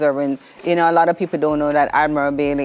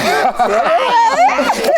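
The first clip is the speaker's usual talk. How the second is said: laughing; 4 dB louder than usual. Real, not acted. Laughing while answering host.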